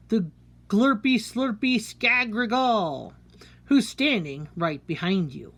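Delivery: spooky poem reading. A narrator's reading. Warning listeners of the Skagrigal, spooky poem read. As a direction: spin a scary tale for kids.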